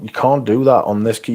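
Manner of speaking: ordinary talk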